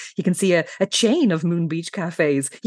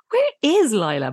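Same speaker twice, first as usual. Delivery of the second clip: higher, emphatic "is" in "Where is Lila?"; stress on IS; 5 semitones higher than usual. Retold or imitated. Co-host voicing Jessica asking after Lila, "is" stressed. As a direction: lean on "is" as the puzzled question's key word. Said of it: "with slightly more emphasis this time".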